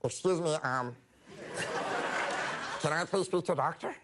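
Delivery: squeaky high helium voice; tiny high helium voice. Retold or imitated. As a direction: talk as if you just sucked a balloon. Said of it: "sounded like I was in a terrible helium accident".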